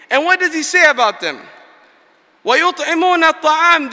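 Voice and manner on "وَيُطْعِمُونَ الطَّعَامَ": rapid chanted Quran verse; quick recitation. Acted. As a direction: recite the verse swiftly in Arabic.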